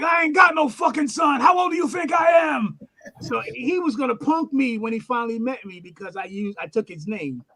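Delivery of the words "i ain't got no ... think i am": high, loud mock outrage; retold indignant yell, pitched way up; indignant shout. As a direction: act out an offended man's protest. Acts out idol's indignant protest.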